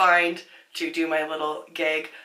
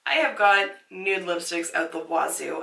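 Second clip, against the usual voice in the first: ordinary talk, nothing marked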